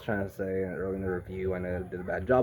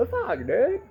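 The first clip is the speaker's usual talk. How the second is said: squeaky high mock curse, laughing; imitating Yelena high and giddy; high mock exclaim; 14 semitones higher, 3 dB louder than usual. Yelena swearing at the shooting, in a high squeaky voice. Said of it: "Yelena's all like".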